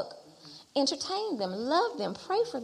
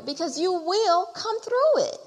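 rising from firm to a shouted, higher climax; building preaching; 9 semitones higher, 6 dB louder, slower than usual. Real, not acted. Preaching crescendo.

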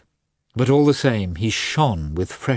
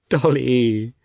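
higher, tearful call of her name; sobbing; 4 semitones higher, 3 dB louder than usual. A narrator's reading. Husband calls wife, now sobbing. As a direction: call to her through sobs. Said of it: "now actually sobbing".

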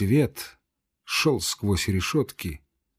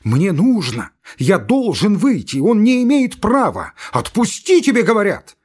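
much higher, louder yelling at the guard; furious shout; 9 semitones higher, 5 dB louder than usual. Acted. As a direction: scream abuse through the door. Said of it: "крикнул Иван Дмитрич".

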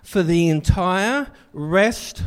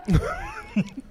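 laugh; 6 dB quieter than usual. Real, not acted. Laughs after his complaint.

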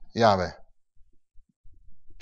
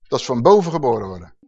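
usual speaking voice

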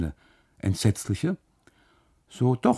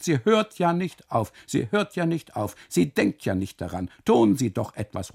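frantic cries; 4 semitones higher, 4 dB louder than usual. Acted. Custodian begging the husband to stop his wife, frantic. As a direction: cry out for help in panic. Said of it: "ruft er".

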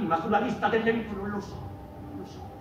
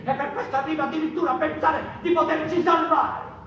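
rising mocking outburst; 7 semitones higher, 4 dB louder than usual.

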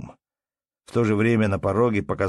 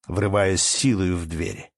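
ordinary delivery, nothing marked

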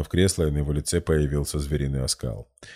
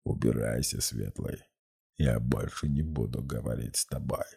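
demonic voice; 5 semitones lower, 7 dB quieter than usual. A narrator's reading. Demon dismisses the hero.

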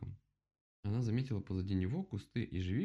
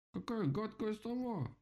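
high, slow little-vole outrage; indignant; 13 semitones higher, slower than usual. A narrator's reading. Vole condemns the nasty owl, indignant. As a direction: draw out the squeaky disgust. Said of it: "возмутилась полевка".

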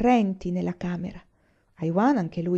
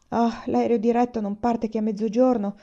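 ordinary delivery, nothing marked

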